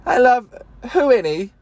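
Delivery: high, hammed-up Shakespearean flourish; mock theatrical. Loving his own nonsense phrase, mock grand. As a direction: declaim "who any" like a stage actor. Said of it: "sounds like some Shakespeare shit".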